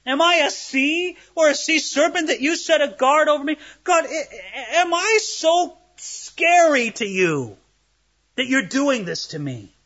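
high, pleading, near-tearful cry; crying out to God. Retold or imitated. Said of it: "As Job cried out to God".

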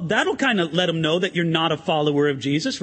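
normal talking voice

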